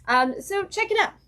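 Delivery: ordinary talk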